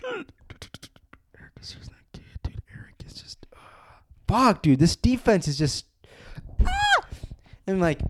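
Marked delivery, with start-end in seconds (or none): 0.0-3.6 whispered aside, much softer; under-breath whisper. Real, not acted. Fan dissing a defender to listeners, hushed whisper. 6.6-7.0 sudden very high frustrated cry; a short strangled shriek of frustration; frustrated yelp. Real, not acted. Fan fed up with his team's defense, high yelp.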